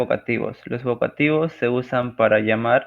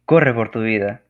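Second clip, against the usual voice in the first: urgent shout; 7 dB louder than usual. Shown as a demonstration. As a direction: shout the warning, much louder. Reading exclamation example.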